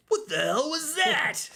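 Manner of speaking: high, raspy, slow clown bark; Krusty the Clown voice. Acted. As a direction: squawk it like a cartoon clown. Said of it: "Krusty Cr-, Clown impression".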